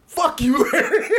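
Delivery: a high laughing curse that breaks into giggles. Retold or imitated. Retelling to his friend how he cursed Larry out, laughing. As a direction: laugh your way through the insult.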